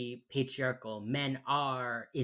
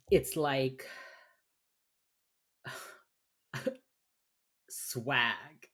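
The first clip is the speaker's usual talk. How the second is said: ordinary talk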